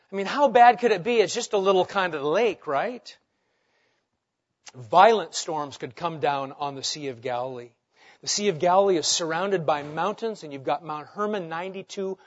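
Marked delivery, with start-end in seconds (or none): none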